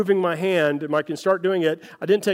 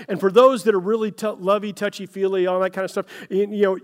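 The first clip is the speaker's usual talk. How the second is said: ordinary delivery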